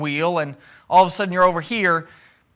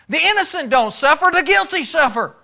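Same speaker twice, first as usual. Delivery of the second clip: higher, fast and slick, like a catchy slogan; glib slogan; 7 semitones higher than usual. Retold or imitated. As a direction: sell it as a neat saying. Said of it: "it sounds good when you say it fast".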